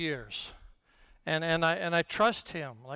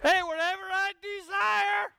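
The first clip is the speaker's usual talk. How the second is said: high, shouted hammed-up glee; joking victory shout over found glasses; mock triumph; 11 semitones higher than usual. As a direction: crow it like you just won. Mock triumphant miracle joke.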